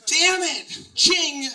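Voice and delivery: high, loud exasperated outburst; hammed-up annoyance; mock frustration. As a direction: yell at the assistant in jest. Mock anger at assistant.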